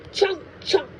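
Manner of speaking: high munching chomps. Acted. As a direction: snap the teeth like biting a bug. Grandma acting out biting.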